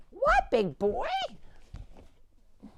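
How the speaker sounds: high cooing pet voice. Real, not acted. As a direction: coo at the little goat. Cooing at the baby goat.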